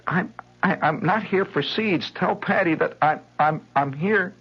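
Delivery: put-on high, stammering small-boy voice; flustered little boy. Boy insists to the mother that he's not selling seeds. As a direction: stumble over it, desperate.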